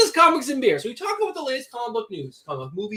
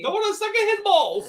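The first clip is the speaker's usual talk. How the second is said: put-on Italian accent; 10 semitones higher than usual. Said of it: "terrible Italian accent".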